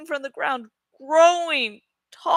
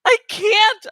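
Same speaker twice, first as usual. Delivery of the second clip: higher, more anguished repeat; desperate cry; 4 semitones higher, 5 dB louder than usual. Acted. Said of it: "I cried".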